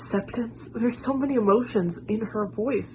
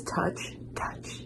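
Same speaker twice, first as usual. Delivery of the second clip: hushed, near-whispered "Touch, touch"; soft breathy imitation; 11 dB quieter than usual. Imitating the singer's gentle line, hushed. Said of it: "the way she says".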